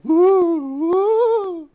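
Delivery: high ghostly hoot; spooky woo. Pretend. Spooky hooting in game.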